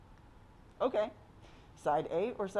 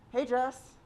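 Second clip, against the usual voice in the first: higher, louder call across to a friend; 8 semitones higher, 5 dB louder than usual. Retold or imitated. Girl calling out to her friend Jess, a bright shout. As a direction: call her name to catch her attention.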